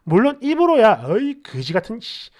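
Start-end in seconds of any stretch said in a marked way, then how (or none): 1.1-2.3 low, softer grumbled swearing; muttered cursing. Retold or imitated. Brother grudgingly bringing his sister an umbrella, muttered cursing. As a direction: curse under your breath, half-hearted. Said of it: "욕하겠지만 그래도 들고는 나와요".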